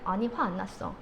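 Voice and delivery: low, soft, displeased denial; sulky denial. Acted. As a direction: deny being angry while sounding put out. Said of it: "목소리가 별론데?". (화났으면서 낮게 아닌 척)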